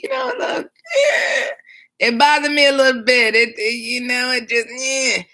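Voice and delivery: high cracking voice, close to tears; choked up, fighting to keep talking; voice breaking. Real, not acted. Dispatcher choking up recalling a caller in distress, voice breaking.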